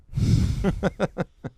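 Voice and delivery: high, loud breathy laugh; burst of laughter. Real, not acted. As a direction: laugh out at the punchline. Laughing at the reveal.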